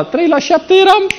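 very high, stretched-out delivery; squeaky-high, slow punchline; comic exaggeration. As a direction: draw it out high for comic effect. Comic boast of early rising.

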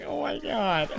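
real voice crack up high; exasperated, voice breaking upward. Real, not acted. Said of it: "Why is my voice cracking?".

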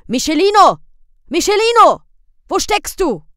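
loud, high calling for a lost son; loud anxious calling. A narrator's reading. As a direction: shout for the boy across the street. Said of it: "Marcovaldo rief".

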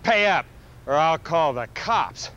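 a low, slow threat to pay up; demanding lady. Retold or imitated. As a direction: lay down the ultimatum slowly and low. Lady demanding payment for ride.

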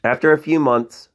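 usual speaking voice

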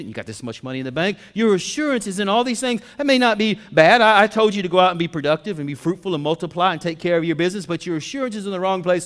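loud, high, driving sermon delivery; full-force preaching. Real, not acted. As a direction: preach hard and build, hammering each point.

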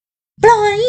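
high, loud, drawn-out scream; scream at full voice. Acted. Woman screaming at her captor with all her strength, high loud scream. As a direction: scream it with everything left. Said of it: "ฝันตะโกนสุดเสียง".

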